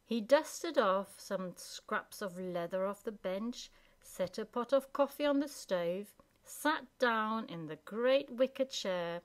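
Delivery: ordinary delivery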